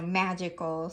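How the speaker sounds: ordinary talk, nothing marked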